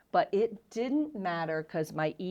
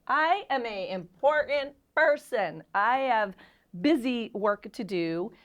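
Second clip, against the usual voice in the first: louder, higher, slow pompous boast; imitating her old arrogant self; mock self-importance; 5 semitones higher, 5 dB louder, slower than usual. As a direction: puff yourself up like a big shot. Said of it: "was my attitude".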